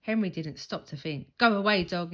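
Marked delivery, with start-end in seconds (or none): 1.4-2.1 much louder fierce howl; horrid howl. A narrator's reading. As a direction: howl to scare off the dog. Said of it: "he howled in his most horrid voice".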